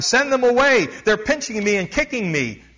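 high, loud panicked cry for help; frantic shout. Retold or imitated. Terrified medium begging the missionary at the door, shouting. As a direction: shout it out in terror. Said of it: "wide-eyed and shouting".

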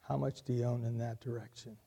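soft, quick retold question; hushed, brisk quote; quietly said. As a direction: repeat it quietly, as if quoting. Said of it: "quietly said".

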